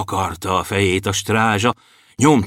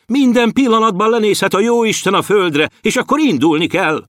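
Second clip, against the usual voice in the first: high-pitched, exasperated reprimand; mild scolding; 11 semitones higher than usual. A narrator's reading. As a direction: scold them with raised pitch. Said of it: "szitta őket a fejedelem". (emelt hangú, enyhe szidás)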